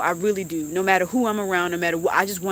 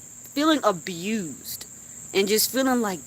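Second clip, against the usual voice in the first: ordinary talk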